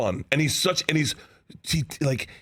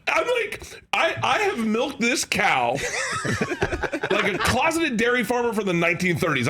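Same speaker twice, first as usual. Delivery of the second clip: laughing hard; 9 semitones higher than usual. Real, not acted. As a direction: laugh your way through the line. Laughing hard through the joke.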